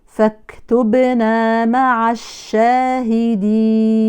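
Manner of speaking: slow chanted "فاكتبنا" with the breathy kaf; correct recitation. Shown as a demonstration. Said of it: "فلو حولنا النفس إلى صوت".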